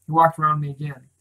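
plain reading voice

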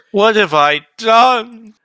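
high, loud, drawn-out mock despair; anguished commander. Commander seeing the disaster he tried to avoid, mock anguish. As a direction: wail it slowly, hammed-up horror. Acting the horrified commander in retelling.

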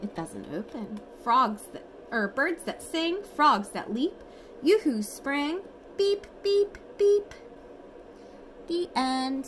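lively storybook reading, sing-song; reading to children. Acted. Parent reads bedtime picture book to toddler, lively sing-song. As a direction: read it brightly for a toddler at bedtime.

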